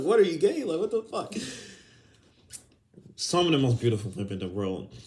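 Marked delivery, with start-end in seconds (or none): 0.0-1.3 high, rising mock accusation. Showing how tone changes the question, high mocking delivery. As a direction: toss it out high and incredulous. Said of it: "just how the tone you say it".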